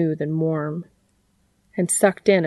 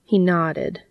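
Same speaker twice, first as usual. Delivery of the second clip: ordinary delivery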